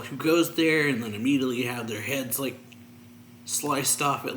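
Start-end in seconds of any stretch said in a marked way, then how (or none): 0.0-0.9 mock yell. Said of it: "these two guards yell".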